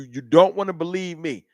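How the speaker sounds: ordinary talk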